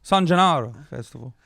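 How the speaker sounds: San Gennaro with an Italian lilt; Italian accent. Shown as a demonstration. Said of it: "you're just doing a, like, an accent".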